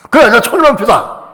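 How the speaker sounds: high, loud, brazen retort; defiant to the devil. Shown as a demonstration. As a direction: talk back to the devil. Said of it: "마귀한테 그래야 돼".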